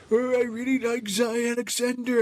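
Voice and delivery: high, put-on old man voice. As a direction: do a creaky old man impression of your co-host. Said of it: "I give you my old man voice".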